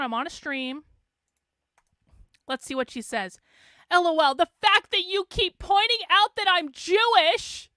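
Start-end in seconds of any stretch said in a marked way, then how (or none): 3.9-7.7 higher, louder mock tweet voice; mocking read-aloud. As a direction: read an opponent's message out loud, hammed up. Reading out an opponent's tweet mockingly.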